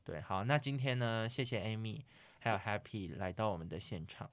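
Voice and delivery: ordinary talk, nothing marked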